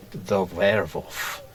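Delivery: The Werewolf said as "Vervolf", slow German accent. Shown as a demonstration. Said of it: "you have to say it with a German accent".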